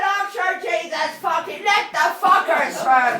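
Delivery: loud, slowed-down exasperated yell; retold exasperated shout. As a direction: throw up your hands and shout. Retold gunman lets them through.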